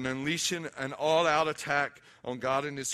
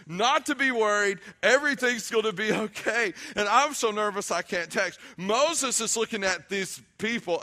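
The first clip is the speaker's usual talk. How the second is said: high, raised preacher's voice; emphatic, driving sermon delivery; impassioned preaching; 7 semitones higher, 6 dB louder than usual. Real, not acted. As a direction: press the point home to the congregation. Preaching at full force.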